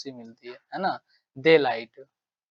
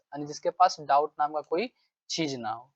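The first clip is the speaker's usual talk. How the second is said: ordinary talk, nothing marked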